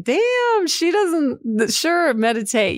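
high, scoffing sarcasm; mock the hypocrite meditator from above; imagined viewer, high and sneering; sarcastic mock viewer. Imagined viewers mocking her.

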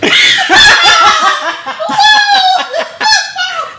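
very high, loud squealing laugh; shrieking laughter. Real, not acted. Host cracking up at own joke, long shrieking laugh. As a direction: lose it completely.

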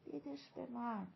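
very soft, near-whispered; barely voiced quiet aside; hushed, gestured request. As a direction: say it under your breath. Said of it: "با ایما و اشاره گفت".

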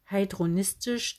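usual speaking voice

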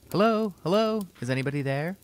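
put-on boy shouting. A narrator's reading. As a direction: call out down the passage, searching. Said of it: "young Reggie's voice shouting".

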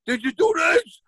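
high, shouted interrogation; heated. Retold or imitated. Dad grilling his kid on assignments, heated. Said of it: "getting fucking more and more heated".

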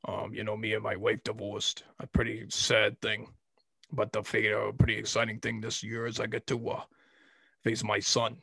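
low, soft put-on football coach voice; Coach Boyd impression. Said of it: "what I said in the Coach Boyd voice".

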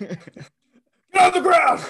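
high loud cop command; shouted order. Acted. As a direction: yell the arrest order. Detective orders arrest.

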